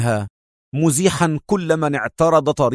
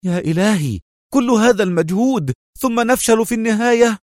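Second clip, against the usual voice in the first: high-pitched cry of dismay; alarmed outburst climbing high; despairing Magdy; 8 semitones higher than usual. A narrator's reading. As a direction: wail in panic at the failure. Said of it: "هتف مجدي في جزع".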